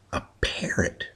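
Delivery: whispered reveal of the parrot; soft secretive whisper. Real, not acted. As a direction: breathe it out like a secret. Punchline setup whispered.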